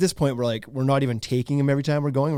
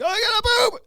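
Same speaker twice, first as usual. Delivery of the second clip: very high imitation of a small child; kid needing toilet; 4 dB louder than usual. Child interrupts lunch to parent, high urgent kid voice. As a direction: squeal it like an urgent toddler. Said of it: "and he's like".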